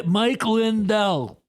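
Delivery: bad Mike Lindell impression. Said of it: "that was a bad imitation".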